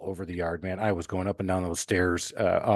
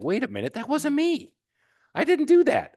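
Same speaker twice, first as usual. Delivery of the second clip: protesting; 4 dB louder than usual. Retold or imitated. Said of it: "And I was like".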